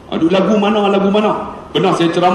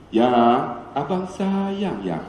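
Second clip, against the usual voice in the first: soft, drawn-out sweet talk; cakap lembut; 4 semitones lower, 6 dB quieter, much slower than usual. Retold or imitated. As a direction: soothe your wife slowly and very softly. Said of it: "Saya cakap lembut". (pujuk isteri dengan perlahan dan lembut)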